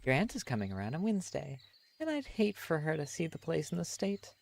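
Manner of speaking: a put-on mother worrying about the aunt's visit; parent voice. Streamer voicing a parent figure.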